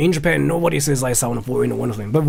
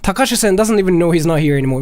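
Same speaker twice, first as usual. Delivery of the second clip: ordinary talk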